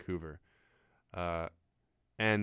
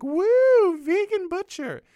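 much higher, loud whooping shout of "vegan butcher"; heckler calling out; 11 dB louder, much slower than usual. Retold or imitated. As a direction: yell it from the crowd like an excited fan. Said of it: "some woman calls out".

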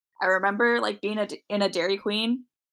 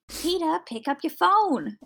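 higher-pitched, drawn-out cartoon voice; bad cartoon impression; 8 semitones higher, slower than usual. Mimicking a funny Family Guy ringtone, bad impression. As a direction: do a lousy cartoon character impression, dragging the end. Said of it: "shitty impression".

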